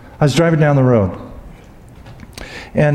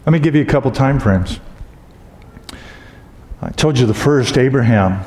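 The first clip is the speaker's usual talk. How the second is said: ordinary talk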